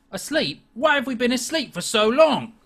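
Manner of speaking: pygmy owl bristling at the sailor, pitched high; indignant owl. Acted. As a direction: puff up like a tiny angry bird. Player voices Pebble the owl.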